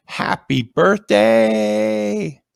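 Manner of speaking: very high, slow drawn-out birthday wish; sing-song greeting. Pretend. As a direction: stretch it out high like a cheer. Greeting read from chat.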